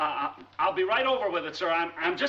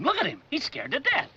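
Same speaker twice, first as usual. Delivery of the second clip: normal talking voice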